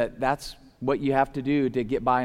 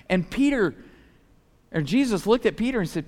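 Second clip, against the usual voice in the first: ordinary talk, nothing marked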